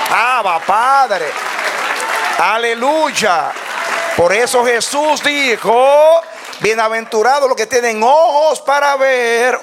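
high, ringing sermon with stretched words; drawn-out, rising shouts of praise; full-force preaching. Real, not acted. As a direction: preach it out to a cheering congregation.

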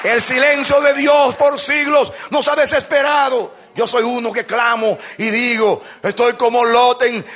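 relentless pulpit crying out; clamor de predicador. Real, not acted. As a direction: cry out to God, phrase after phrase. Preaches a cry to God. (clamor enérgico desde el púlpito)

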